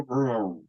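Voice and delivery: low, soft, falling "rrr" sound; calming down. Retold or imitated. Agitated man soothed by a touch or voice, calming. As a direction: let the agitation wind down in one long soft sound. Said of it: "he would calm me down".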